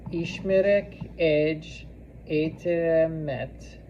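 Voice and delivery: drawn-out, word-by-word delivery; slow dictation. Shown as a demonstration. Teacher dictating as students write it down, slowly. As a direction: pace it for someone writing along.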